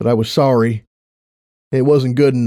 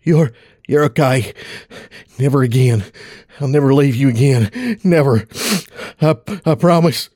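choked up; 2 semitones higher than usual. Acted. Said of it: "It was hard to speak".

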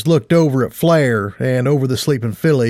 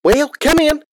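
much higher inviting voice; from inside a building. Acted. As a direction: call them in from inside. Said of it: "the voice said".

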